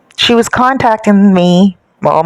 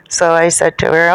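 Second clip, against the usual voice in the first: plain reading voice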